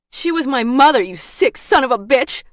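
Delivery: high, loud outraged yell; yelled in outrage; angry yell. A narrator's reading. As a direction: hurl the insult at the top of your voice. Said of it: "Sooner yelled".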